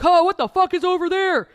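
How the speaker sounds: high, loud startled yell; loud passer-by. Retold or imitated. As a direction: holler it like a gawking passer-by. Mimicking loud passers-by in story.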